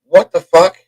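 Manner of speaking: high, loud startled outburst. Retold or imitated. Couple hears thumps in an empty house, loud shocked outburst. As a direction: jump at the noise. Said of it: "we both go".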